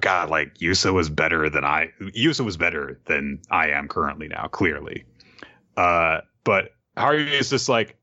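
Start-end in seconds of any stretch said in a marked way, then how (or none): none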